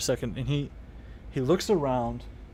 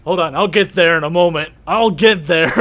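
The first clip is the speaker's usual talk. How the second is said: raised voice, holding off interrupters; loud protest; 8 dB louder than usual. Real, not acted. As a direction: push back loudly over the others. Game master holds off interrupting players.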